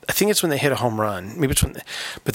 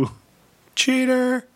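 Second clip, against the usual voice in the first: heckling; 14 semitones higher than usual. Pretend. Heckling the batter.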